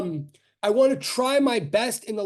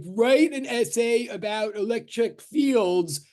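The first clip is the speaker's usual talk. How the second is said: slow dictation; 2 semitones higher, slower than usual. Retold or imitated. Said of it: "if I said".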